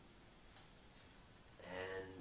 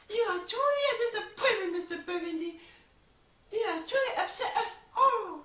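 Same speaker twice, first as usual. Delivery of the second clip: put-on much higher, prim scolding child; little girl voice; 13 dB louder than usual. The little girl scolding Ron, very high and prim. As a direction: scold like a tiny disappointed girl. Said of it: "the little girl was just".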